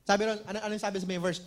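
ordinary talk, nothing marked

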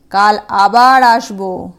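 loud, slow demon threat; menacing demon. Acted. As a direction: growl the promise as a demon. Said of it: "যাবার সময় বলে গেল". (ভয় দেখানো দানবের গলা)

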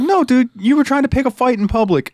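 high-pitched cartoonish scolding; exasperated retort. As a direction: set him straight, high and slowing at the end. Answering the cartoon character's protest.

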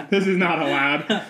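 laughing through the words. Real, not acted. Laughing at bleep joke.

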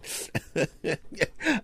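a high, unvoiced giggle; breathy giggle. Real, not acted. Laughing at himself on strong medication: high unvoiced giggle. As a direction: giggle helplessly under your breath.

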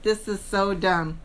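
low, soft, slow grumble; grumbled aside. Retold or imitated. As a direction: mutter it like you're over it. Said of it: "So Mario's like".

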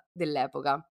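normal talking voice

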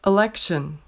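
"Election" with no opening vowel, LEK-shun; first vowel dropped. Shown as a demonstration. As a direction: clip off the start of "Election". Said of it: "four options for the correct pronunciation".

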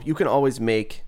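ordinary delivery

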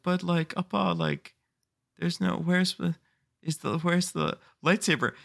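put-on small, high kid voice; halting, high-pitched mock disappointment; let-down child. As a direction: imitate a confused child faltering to his father. Let-down kid questions his dad.

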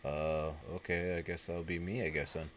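low, soft, unsure mock mumble; hesitant acceptance. Boyfriend decides the announced call is his, hesitant acceptance. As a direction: imitate a man reluctantly volunteering, voice dropped.

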